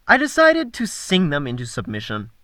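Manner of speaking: plain reading voice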